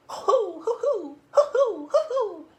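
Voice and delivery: high, loud owl hooting; hoo-hoo owl call; owl hoots. Acted. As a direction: hoot like the wise old owl.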